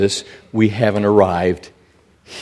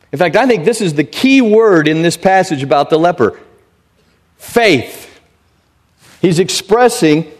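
normal talking voice

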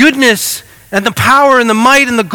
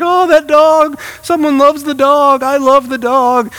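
teary gush; 6 semitones higher than usual. Retold or imitated. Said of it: "I'm like".

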